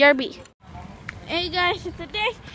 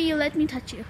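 ordinary talk, nothing marked

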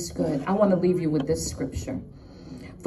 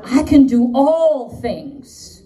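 bold, drawn-out declaration, much louder; New York attitude; 6 semitones higher, 7 dB louder, slower than usual. Shown as a demonstration. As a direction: proclaim it slowly with New York swagger. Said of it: "say it with my New York attitude".

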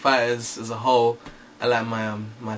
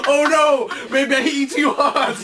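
laughing high mock fighter voice, much higher; mock seizure guy; 11 semitones higher than usual. Mocking Ken's taunt, high and laughing. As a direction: ham up a dazed fighter through laughter. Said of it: "it's like a man's having a seizure".

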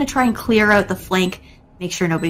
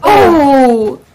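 surprised shout; 6 semitones higher, 8 dB louder than usual. Real, not acted. Caster reacts to sudden play.

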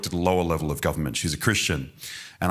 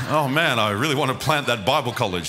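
eager plea; 4 semitones higher than usual. Retold or imitated. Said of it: "I was like".

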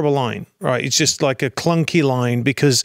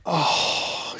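breathy oh; 3 semitones higher, 6 dB quieter than usual. Retold or imitated. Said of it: "And I went".

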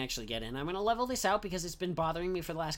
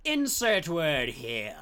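mock announcer; 3 semitones higher, 9 dB louder, much slower than usual. Announcer-style slow line.

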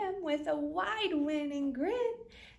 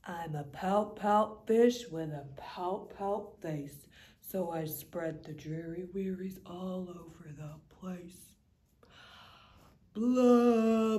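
glum pout fish; 2 semitones lower than usual. Acted. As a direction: sink into the gloom of a sulky fish. Fish answers squid and octopus.

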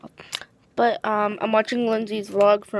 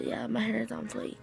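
whisper; 10 dB quieter than usual. Real, not acted. Showing off hair, whispered.

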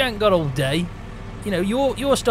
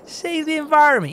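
incredulous scoff; 9 semitones higher, 4 dB louder than usual. Real, not acted. Mocking his excuse.

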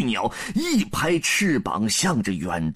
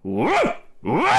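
two sharp barks; dog barks; 2 semitones higher than usual. A narrator's reading. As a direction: bark like a hunting dog. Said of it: "两声狗叫".

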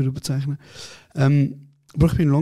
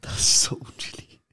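whisper; 4 dB quieter than usual. Real, not acted. Friend whispers comment.

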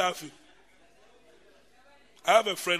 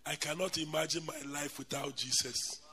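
soft quote; 5 semitones lower, 8 dB quieter than usual. Retold or imitated. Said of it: "I've never heard you say".

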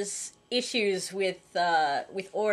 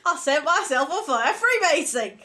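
loud, high put-on accent; Richard Pryor, botched impression; 7 semitones higher, 7 dB louder than usual. Co-host continuing the comedian bit, botched shouted impression. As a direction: shout it as a deliberately bad impression. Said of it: "I'm Richard Pryor".

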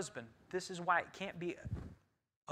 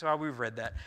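ordinary delivery

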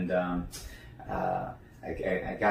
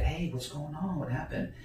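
soft, higher-pitched concerned check-in; gentle questioning; 6 semitones higher, 6 dB quieter than usual. Retold or imitated. Team leader checking on upset teammate, soft concern. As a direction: ask quietly what went wrong, voice lifted. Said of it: "And I said".

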